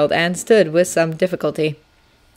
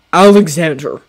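sharp warning; 7 dB louder than usual. Acted. General cuts him off.

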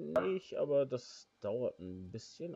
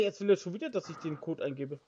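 ordinary talk, nothing marked

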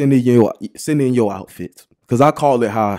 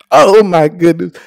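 amazed exclaim; 5 semitones higher, 8 dB louder than usual. Real, not acted. Hyped reaction.

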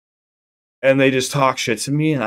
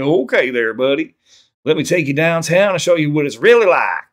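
exaggerated Southern accent, pushed louder; mock Southern accent; 5 semitones higher, 5 dB louder than usual. Imitating a Southerner offering to show someone around, mock Southern accent. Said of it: "I don't like when people try to, like make fun of it".

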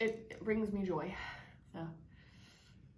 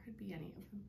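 whisper; 11 dB quieter, faster than usual. Real, not acted. Babies napping nearby.

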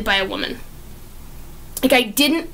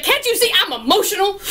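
high, quick, through laughter; mock emotional outburst; 7 semitones higher, faster than usual. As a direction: play a hammed-up upset heroine while laughing.

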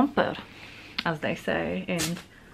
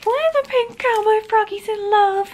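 much higher and louder, slow sugary cutesy voice; cutesy baby voice; 7 dB louder than usual. Retold or imitated. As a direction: coo it like a lovestruck cartoon. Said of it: "I said to my partner".

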